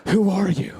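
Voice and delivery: mock stunned fighter gasping it out high and loud; dazed fighter. Beaten guy on the ground asking his attacker, mock awed.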